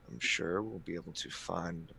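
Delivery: soft, halting appeal to a superior; quiet, hesitant diplomacy with long pauses; careful entreaty. Acted. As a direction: choose each word carefully, voice held down. Player pleads with the prince.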